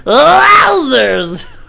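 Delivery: squealing laugh into wowzers; high loud laughing squeal; high laughing cry. Retold or imitated. Still replaying the roadside laugh, squealing high. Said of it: "reenacting his laugh".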